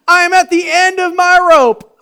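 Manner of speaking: loud, high wail of being done; exasperated cry. Retold or imitated. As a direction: cry out at the end of your rope. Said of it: "congregant quoted".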